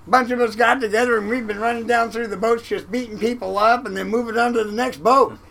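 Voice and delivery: high and loud all through; loud cheerful explanation. Retold or imitated. The crewmate explains the boat raids in a loud, breezy voice. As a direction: boom it out like it's nothing.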